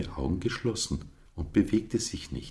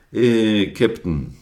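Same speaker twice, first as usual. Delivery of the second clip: louder, hesitant call to the ram; 6 semitones higher, 10 dB louder, slower than usual. A narrator's reading. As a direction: get his attention at the door. Flori calls the ram.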